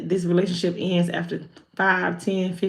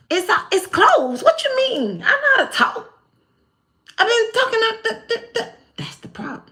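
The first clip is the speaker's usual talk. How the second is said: much higher, indignant disbelief; incredulous; 12 semitones higher than usual. Retold or imitated. Someone told their throat chakra is closed, high indignant disbelief. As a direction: squeak out the disbelief.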